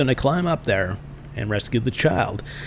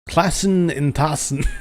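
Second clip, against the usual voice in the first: put-on, slow German accent; mock German; 5 semitones higher, slower than usual. Master dismissing the class, in badly faked German. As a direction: sign off in made-up German. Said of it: "not really that familiar with the German".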